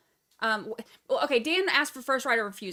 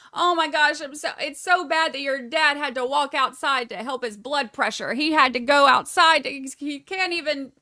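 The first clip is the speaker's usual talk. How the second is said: loud, hammed-up fretting; mock whining complaint; 7 dB louder than usual. Mother whining to Charlie about his dad, loud mock complaining. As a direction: pile up the complaints in an overwrought mock rant. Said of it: "Donna is whining and complaining so much".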